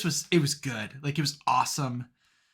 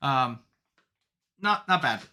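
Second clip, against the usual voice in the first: ordinary talk, nothing marked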